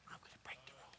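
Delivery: whispered aside to himself. Real, not acted. Pastor sneaking the phone up to the pulpit, whispering. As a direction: breathe it under your breath.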